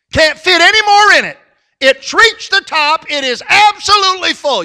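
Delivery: high, loud pulpit delivery; full-force preaching. Acted. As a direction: cry it out to the congregation.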